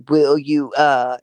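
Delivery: lightly mock the man from the clip; imitating the man. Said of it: "When he".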